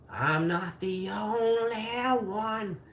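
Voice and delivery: high, drawn-out mock wail; slow, high and whiny sing-out. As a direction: stretch ONLY into a long, rising high wail. Repeats line drawn out, high.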